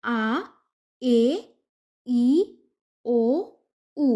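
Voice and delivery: each Spanish vowel spoken slowly and clearly on its own: আ, এ, ই, ও, উ. Shown as a demonstration. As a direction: spell out the five vowels one by one. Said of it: "মানে স্বরবর্ণ".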